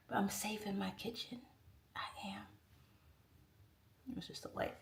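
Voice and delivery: soft conspiratorial whisper. Real, not acted. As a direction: whisper it like a secret. Whispering her secret to viewers.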